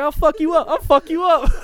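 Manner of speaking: high, loud mock threat shouted twice; yelling a fourteen-year-old's threat; retold threat yell. Teen yelling a threat at an older student, loud. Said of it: "I was scoring the fuck up".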